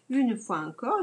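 ordinary delivery

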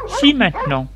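storyteller's high voice for the wife; contradicting wife. A narrator's reading. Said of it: "Elle lui dit".

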